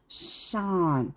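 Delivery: sun with lips spread wide, hissing s; spread-lip S demo. Shown as a demonstration. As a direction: stretch the mouth sideways on sun. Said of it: "sun 이렇게 찢어요". (입을 옆으로 찢으며 sun)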